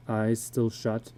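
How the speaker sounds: usual speaking voice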